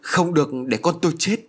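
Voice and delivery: a narrator's mother pleading in alarm; crying out. A narrator's reading. Mother begging that her child not die, mildly crying out. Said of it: "bà Tư kêu lên".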